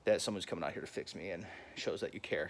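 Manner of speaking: low, hushed voice for a whispering tractor; mock whispering. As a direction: murmur it softly, pretending to confide thanks. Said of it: "I was whispering, saying".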